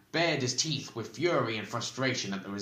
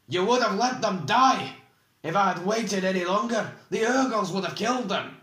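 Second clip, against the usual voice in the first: loud, high, indignant protest; heated retort; 9 semitones higher, 6 dB louder than usual. A narrator's reading. Oric bristling at the bald man's accusation, heatedly. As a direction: fire back in outrage.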